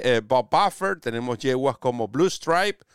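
ordinary talk

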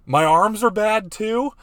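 high, loud, slow offended question; hammed-up wounded indignation; mock outrage. Wife taking offence at a suggestion about arms, mock outrage.

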